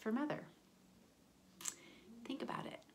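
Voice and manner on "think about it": hushed prompt; whisper. Real, not acted. Teacher whispers a prompt to the children. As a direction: whisper it to the class.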